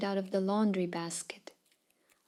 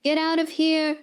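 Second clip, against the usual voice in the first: higher, louder teasing yell; mock parrot yell; 6 semitones higher, 3 dB louder than usual. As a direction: shout it like a parrot mocking the dog. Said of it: "the parrot yells".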